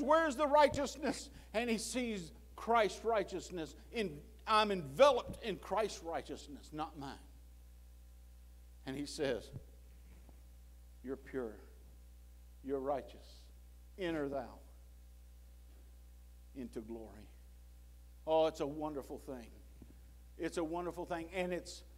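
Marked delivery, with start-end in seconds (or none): 0.0-1.3 high, loud challenging question; God as judge questioning the sinner, high and loud; judge's demand. Acted. God as great judge asks. 11.0-17.3 low, soft and gentle; God welcoming the saved sinner, soft and low; tender welcome. Acted.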